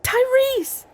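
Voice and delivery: high-pitched, urgent shout of a name; calling out. Acted. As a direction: shout to get his attention. Calling out to Tyrese in alarm.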